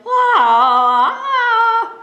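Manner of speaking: long high drawn-out yell; muffled yell. Acted. Wolf buried under bugs, long high wail. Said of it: "something was yelling".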